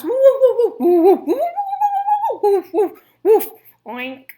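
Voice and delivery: loud laughing woofs ending in an oink; pigs barking like dogs. Acted. Pigs bark at Farmer Fred as a joke, loud laughing woofs. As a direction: bark like a dog, then slip into an oink. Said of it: "they started barking like dogs".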